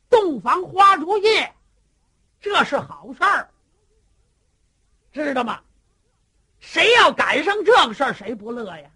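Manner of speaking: loud coaxing scold; hearty lecturing. Acted. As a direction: boom it out like a blustering elder brother. Cheng Yaojin loudly lectures Wang Yan.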